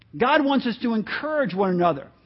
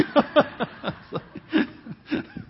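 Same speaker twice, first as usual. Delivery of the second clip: laughter; 7 semitones higher than usual. Real, not acted. Congregation laughter and pastor.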